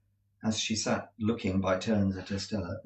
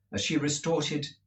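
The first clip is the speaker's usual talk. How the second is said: ordinary delivery, nothing marked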